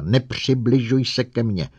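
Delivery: high, loud furious order; shrieking at robot. A narrator's reading. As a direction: scream it to drive him away. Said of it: "vřískla na robota".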